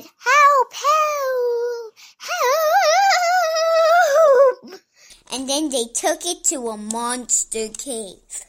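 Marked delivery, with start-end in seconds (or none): 0.0-4.8 high, drawn-out wail, building louder; cry for help. A narrator's reading. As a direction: call for rescue in a hammed-up squeal. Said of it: "And they said".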